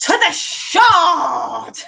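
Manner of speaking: hammed-up call, stretched out long; mock rallying shout. Retold shout at passing posh couple.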